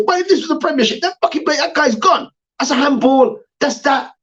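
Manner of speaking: high, fired-up rant; heated rant. Retold or imitated. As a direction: rant it like a wound-up fan. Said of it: "Can you say".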